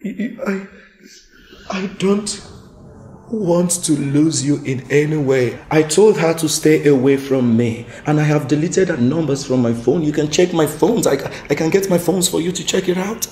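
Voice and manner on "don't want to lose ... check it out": loud, fast frantic explanation; rushed loud begging; loud desperate pleading. Acted. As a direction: plead loudly to keep her. Husband desperately begs forgiveness.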